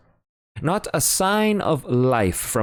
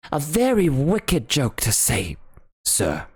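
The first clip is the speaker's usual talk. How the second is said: higher, mildly shocked butler; prim disapproval of the joke; 8 semitones higher than usual. Acted. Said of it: "in a shocked tone".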